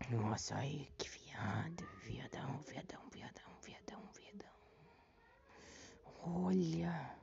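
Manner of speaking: fading breathy whisper. Real, not acted. Whispering after jumpscare.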